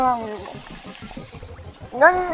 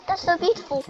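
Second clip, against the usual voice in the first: ordinary delivery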